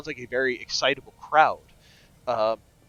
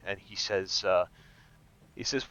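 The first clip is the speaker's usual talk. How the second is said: ordinary talk, nothing marked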